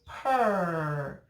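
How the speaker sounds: "purr" drawn out on its R sound; stretched "purr", falling gently in pitch; isolated first syllable. Shown as a demonstration. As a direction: hold "purr" long so the bossy R is heard. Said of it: "at the first syllable".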